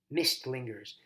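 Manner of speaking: usual speaking voice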